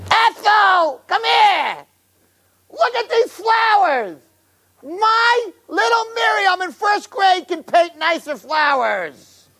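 put-on high, slow, shouted old lady outrage. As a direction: shriek at Ethel like a scandalized yenta. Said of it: "Myrtle says to Ethel".

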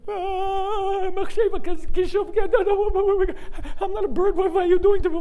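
terrified screaming. Retold or imitated. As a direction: scream to be let down. Shmuel terrified in flight.

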